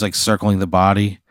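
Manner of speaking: normal talking voice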